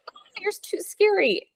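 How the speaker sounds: high, small mock-frightened squeak; playfully scared, very high-pitched voice; retold fright. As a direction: imitate yourself shying away, pitch way up. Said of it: "he scared me so much".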